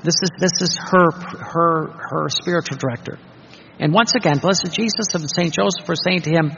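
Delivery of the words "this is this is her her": ordinary delivery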